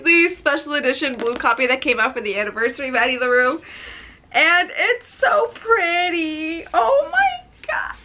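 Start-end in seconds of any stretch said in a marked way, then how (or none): 4.3-8.0 high, slow whine of delight; tearfully moved. Real, not acted. Admiring a gifted book, tearfully moved. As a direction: draw it out, climbing to a tearful squeak.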